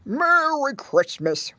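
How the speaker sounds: put-on grunted old pig greeting; Grandpa Squealer. A narrator's reading. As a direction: grunt it out slowly like an aged pig. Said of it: "grunted Grandpa Squealer".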